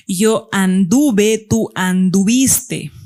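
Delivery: "anduve" and "anduviste" drawn out syllable by syllable; slow conjugation demo. Shown as a demonstration. Teacher conjugates an irregular verb for students, very slow conjugation demo. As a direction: stretch "anduve" and "anduviste" slowly for learners. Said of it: "el lexema está invariable".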